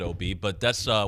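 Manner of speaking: usual speaking voice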